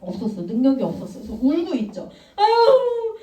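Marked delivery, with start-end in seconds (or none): 2.4-3.2 a pleading wail, pitched up high; begging servant, crying. Acted. Servant begging the king for more time, tearful plea. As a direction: beg the king through mock tears. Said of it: "울면서 얘기를 했어".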